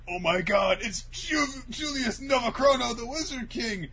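high-pitched, stammering astonishment; mock awed shock. Villains recognising the Wizard King, mock awed shock. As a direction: gasp out his name in hammed-up alarm.

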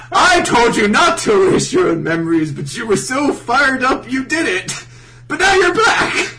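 loud mock proclamation rising to a shout; hammed-up revelation. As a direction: build to a high, triumphant yell. Acted plot twist, building excitement.

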